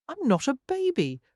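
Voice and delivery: lightly voiced toddler, high and piping; a small child insisting, a touch high; a hint of a cross little boy; indignant toddler. A narrator's reading. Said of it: "piped the toddler indignantly".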